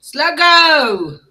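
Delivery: hearty shout of a name; calling out. Real, not acted. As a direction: call out the name, bright and loud.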